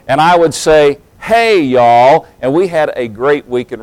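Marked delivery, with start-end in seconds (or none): 1.2-2.3 loud Southern "Hey, y'all" back; preacher's Southern reply; Southern greeting. Retold or imitated. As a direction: answer with a hearty East Tennessee twang. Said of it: "I still know the Southern".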